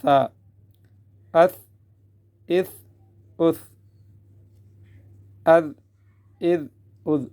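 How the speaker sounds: tongue-tip th and dh, no whistle; soft th, dh. Shown as a demonstration. Teacher shows soft letters, th and dh without whistle. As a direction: let tha and zaal out gently. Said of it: "نرمی سے ادا کرتے ہیں".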